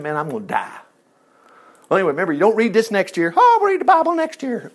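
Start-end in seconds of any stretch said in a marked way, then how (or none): 0.0-0.8 low, soft resignation; hushed, understated gloom; acted despair. Jacob despairing, voiced by preacher. 3.4-4.7 very high put-on voice; hammed-up mocking imitation; mock procrastinator. Mocking someone who puts off reading.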